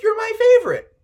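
high, loud, fast gushing praise; gushing star. Pretend. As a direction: gush over your biggest fan. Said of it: "she knows your name and she's like".

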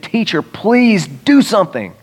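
urgent, stretched-out appeal to a teacher; desperate plea. Acted. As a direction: act out a pleading father. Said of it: "she was desperate and she had faith".

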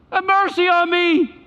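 high, insistent plea across a distance; crying out. Acted. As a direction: call it out again and again, high-pitched. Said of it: "in the distance crying out".

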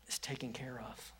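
hushed whisper to close. Acted. Preacher ending Jesus' promise in a whisper. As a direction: drop to a whisper of comfort. Preacher ends quote softly.

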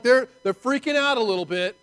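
ordinary talk

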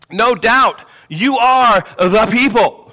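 loud, slow mock acclaim with a sarcastic edge. Preacher voicing Job's retort to his friends, sarcastic. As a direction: proclaim it emphatically, in mock deference. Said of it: "This is kind of his sarcasm kicking in".

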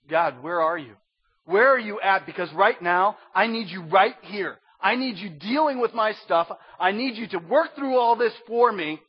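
desperate prayer. Pretend. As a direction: beg God to show up, loud and strained. Congregant prays in the storm.

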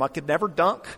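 normal talking voice

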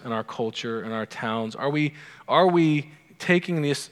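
ordinary talk

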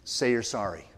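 low, quiet parental instruction; hushed, firm little order; parental prompting. Retold or imitated. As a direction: tell a child to apologize, low and soft. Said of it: "said that famous phrase to Janelle".

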